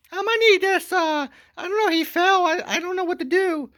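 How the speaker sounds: very high, loud mock lady voice; falsetto woman. Wife asking security to unload the husband, in a high falsetto. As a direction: chirp it sweetly like nothing happened. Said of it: "she's talking to security".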